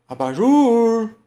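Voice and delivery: high, loud, held last syllable; drawn-out singsong shout; calling at the gate. Shown as a demonstration. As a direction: holler the word like a person's name. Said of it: "você tá chamando o abajur".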